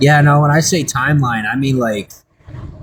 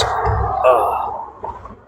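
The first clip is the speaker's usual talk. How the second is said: normal talking voice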